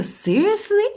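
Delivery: high-pitched questioning "seriously?"; questioning disbelief. Acted. Someone asks if you mean it, questioning. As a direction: ask it high, as if checking it is true. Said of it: "If someone tell you".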